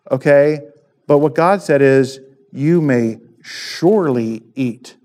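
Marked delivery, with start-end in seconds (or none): 2.5-4.9 "surely" drawn out slowly and set apart; slow down and lean on "surely"; stress on surely. Shown as a demonstration. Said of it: "what I wanna stress here".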